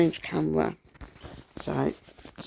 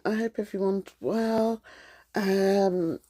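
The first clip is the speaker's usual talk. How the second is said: normal talking voice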